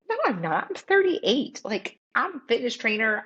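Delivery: high-pitched, incredulous protest; retold denial. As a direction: act out refusing to believe the diagnosis. Denying heart attack to husband.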